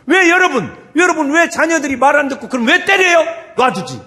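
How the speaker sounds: high, loud rhetorical challenge; shouted reproach. Real, not acted. Preacher challenges parents who hit disobedient children, shouted reproach. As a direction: fire the question at the congregation.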